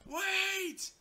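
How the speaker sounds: shout. Real, not acted. Calling out mid-chaos.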